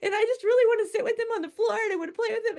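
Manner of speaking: high, fast whiny wail; mock crying. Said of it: "I started crying and".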